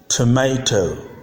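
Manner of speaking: "Tomato" as "too-may-toe"; American pronunciation. Shown as a demonstration. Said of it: "But in American English".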